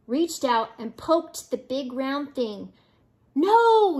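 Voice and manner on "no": high, loud drawn-out cry; yell. Acted. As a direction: yell it like a horrified crowd. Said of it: "yelled the crowd".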